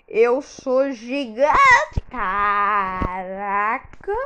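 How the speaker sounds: drawn-out wonder at being huge; slow amazed drawl. Real, not acted. Gamer marvels at turning giant, slow amazed drawl. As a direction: stretch it out, amazed.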